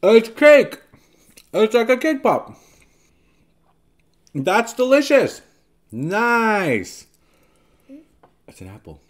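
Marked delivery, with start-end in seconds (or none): 0.0-7.1 delighted. Real, not acted. Delighted tasting reaction.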